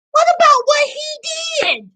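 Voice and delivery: high-pitched, exasperated outcry; worked-up, incredulous challenge; indignant. Real, not acted. Indignant at comments about the wife.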